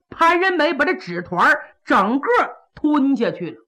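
plain reading voice

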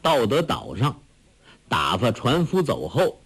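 plain reading voice

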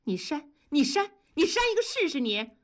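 high-pitched, rapid angry goading; defiant dare. A narrator's reading. Wife lunges at husband, daring him furiously. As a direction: dare him, quickening as you go. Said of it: "立刻扑到了同志的眼前".

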